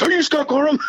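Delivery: high, excited voice of an eager fan; star-struck fan. Retold or imitated. Curator spotting Scott at the museum, high and star-struck.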